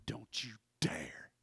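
slow, low whispered warning; hushed warning. Acted. [name] forbidding himself to mention it, whispered. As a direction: warn under the breath, word by word.

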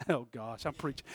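ordinary talk, nothing marked

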